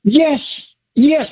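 high emphatic yes; excited yes. Real, not acted. Caller answering the host's doubt, thrilled and high. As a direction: blurt it out thrilled. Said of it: "excitement that you got in your voice".

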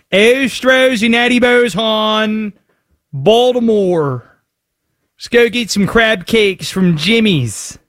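put-on slow, stretched-out Baltimore accent; hammed-up Baltimore accent. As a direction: draw out every vowel like a Dundalk local. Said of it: "sounded like you're straight from Dundalk".

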